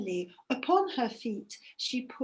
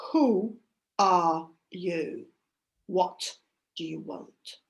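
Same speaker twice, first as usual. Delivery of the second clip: gruff gatekeeper; 7 semitones lower, slower than usual. Acted. Gatekeeper of underworld speaks.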